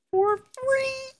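high, hammed-up sales pitch repeat; sing-song pitchman echo; infomercial.

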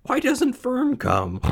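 very high, slow piglet wail; acted drawn-out whimper; starting to cry. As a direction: plead tearfully in a tiny high voice. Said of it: "He began to cry".

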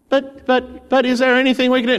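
high, fast, pleading "but, but" of scared townsfolk; frightened city folk begging, voice jumping up and rushing; panicked townsfolk. Retold or imitated. Frightened Ninevites beg the prophet, high and rushed.

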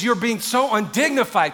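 mock angry wife. Wife telling David off, mock angry. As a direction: rebuke him with acted indignation. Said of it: "gets so angry at David".

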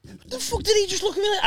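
very high incredulous snap; imitated indignant outburst; mock outrage. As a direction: act out the road-rage shock. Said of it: "and I was like".

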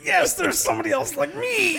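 very high, excited squeal of recognition; real delight. Real, not acted. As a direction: exclaim it high, thrilled to find a kindred spirit. Delighted to find a kindred spirit.

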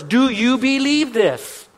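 slow, weight on believe; slow emphatic question. Shown as a demonstration. Preacher pressing the question on the congregation, emphatic. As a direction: stretch "Do you believe this?" and lean on "believe". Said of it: "those last four words".

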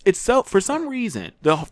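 usual speaking voice

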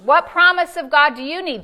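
usual speaking voice

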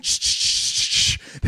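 loud rapid hissing bursts; mock teenage-boy sound effect; imitated frantic noise. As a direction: make rushing "ch-ch-sh" noises. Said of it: "seems like the sound that a, that a teenage boy makes".

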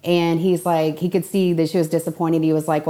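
usual speaking voice

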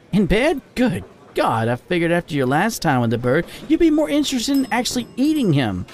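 high, animated female character voice; teasing female friend. Acted.

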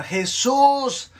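high, drawn-out call for help; narrated cry, pitched well up. A narrator's reading. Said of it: "comenzó a gritar".

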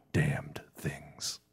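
hissed menacing whisper. Acted. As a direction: breathe it out unvoiced. Menacing narration ends whispered.

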